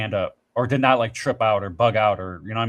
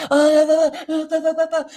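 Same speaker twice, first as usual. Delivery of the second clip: stunned stammer; 7 dB louder than usual. Retold or imitated. Stunned reaction at the test.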